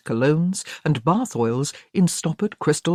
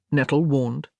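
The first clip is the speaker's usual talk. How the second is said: ordinary delivery, nothing marked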